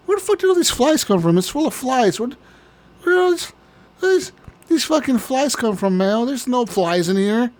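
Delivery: mock bewildered rant about flies; baffled shopper. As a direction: pitch up like you can't believe it.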